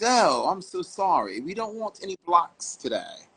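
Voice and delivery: put-on British accent. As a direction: imitate a politely dismissive Brit. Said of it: "he says in a British accent".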